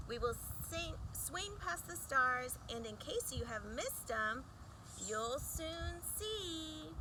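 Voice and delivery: ordinary delivery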